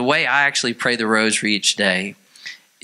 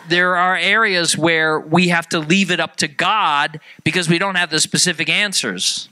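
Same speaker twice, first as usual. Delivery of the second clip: normal talking voice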